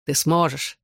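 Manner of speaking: ordinary delivery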